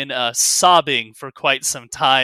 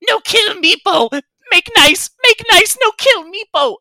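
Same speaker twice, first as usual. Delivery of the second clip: very high, loud begging squeal; panicked squeaky cries; fearful little kobold; 11 dB louder than usual. Acted. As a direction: plead for your life in a tiny creature voice. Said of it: "looks up with fear in his eyes".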